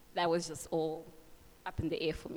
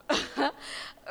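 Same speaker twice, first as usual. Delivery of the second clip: nervous laugh; 8 semitones higher, 4 dB louder than usual. Real, not acted. Nervous laugh after father's threat.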